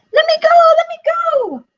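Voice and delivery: very high, loud, frantic squeal. Acted. Piglet kicking in the fox's grip, squealing. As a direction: squeal and shout to break free. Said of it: "kicked and squealed".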